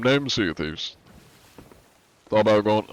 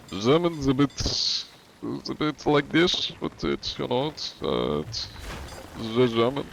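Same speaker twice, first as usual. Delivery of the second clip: hesitant German accent demo; 4 semitones higher, 3 dB quieter than usual. Shown as a demonstration. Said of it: "Do German accent?".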